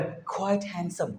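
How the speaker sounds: slow quiet model phrase; slow soft demo. Shown as a demonstration. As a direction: draw it out gently for students. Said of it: "അതിന് പറയുന്നത് പറയാം".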